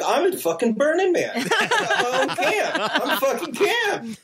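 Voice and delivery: high, laughing triumph; elated. Retold or imitated. Elated at having supplies.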